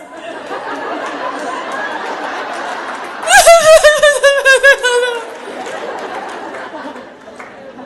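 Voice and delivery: long, high, loud fake sobs; hammed-up wailing cry without words; exaggerated stuttering bawl; mock sobbing. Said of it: "Did I mention crying in uniform is bad".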